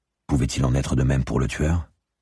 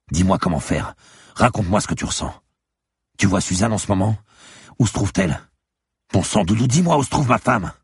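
high, urgent questioning building louder; desperate demand; 8 semitones higher, 4 dB louder than usual. Acted. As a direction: demand answers with mounting desperation. Desperate husband shaking her for answers. (exigence désespérée, de plus en plus forte)

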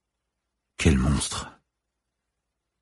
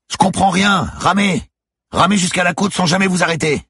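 very high, loud shout; forceful command at full volume; urgent yelled orders; 6 dB louder than usual. A narrator's reading. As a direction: yell at her across the water. Skipper yells to keep rowing.

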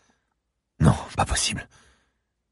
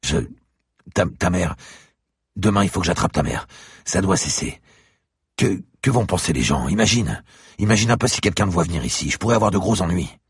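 raised, higher voice, tumbling out in a panic; flustered scolding; 7 semitones higher, 4 dB louder than usual. Acted. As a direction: protest in a rush, more alarmed each line. Franck panics, scolds the girl.

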